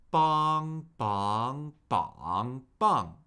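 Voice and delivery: cycle "bong" through flat, up, down-up, down; "bong" four times, each with the next Mandarin tone; four-tone drill. Shown as a demonstration. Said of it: "cycle this syllable through the four tones".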